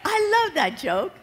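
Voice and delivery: tickled by her own joke; delighted. Real, not acted. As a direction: gush over the punchline. Preacher delighting in punchline.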